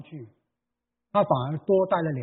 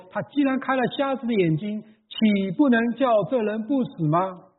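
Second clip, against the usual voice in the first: mocking question; 6 semitones higher than usual. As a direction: challenge him with a jeering tone. 扮演犹太人的质疑. (高声慢慢质问的讥讽语气)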